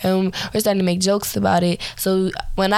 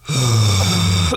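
low guttural growl noise; deep growl; 10 semitones lower than usual. Retold or imitated. Imitating super deep growl.

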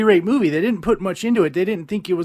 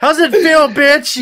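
higher, louder jeering taunt; mocking taunt; 8 semitones higher, 7 dB louder, slower than usual. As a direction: gloat over the beaten bug. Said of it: "mocking the captured bug".

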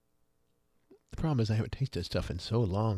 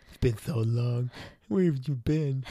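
put-on baby talk to dog; 4 semitones higher, 4 dB louder, slower than usual. Greeting the returning dog.

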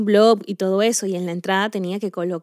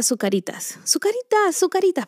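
whispered then high, teasing calls; husband calling her by the teasing nickname in a whispery sing-song; 10 semitones higher than usual. Retold or imitated.

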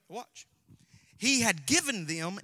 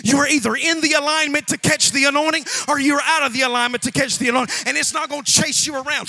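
ordinary talk, nothing marked

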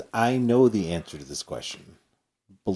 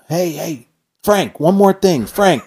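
high, loud call across the room; grandfather yelling; 11 semitones higher, 9 dB louder, slower than usual. Retold or imitated. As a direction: yell after him to come back. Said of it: "my grandfather yells".

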